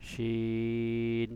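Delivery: held, drawn-out শিন; শিন held long, air streaming from the mouth; long drawn shin. Shown as a demonstration. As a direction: stretch শিন with breath flowing out. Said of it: "আমরা শিনটা উচ্চারণ করি শিন".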